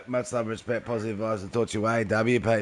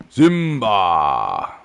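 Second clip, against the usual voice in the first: loud, deep, booming call of Simba; deep booming Mufasa call; 9 dB louder than usual. Pretend. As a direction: call out to Simba like the lion king father. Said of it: "like the Mufasa voice".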